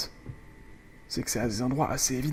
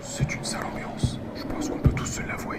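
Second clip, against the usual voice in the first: whispering. Real, not acted. Parle bas pour se cacher.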